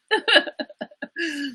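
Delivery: high-pitched laughing fit. Real, not acted. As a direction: laugh hard at your own bit. Laughs after mock confrontation.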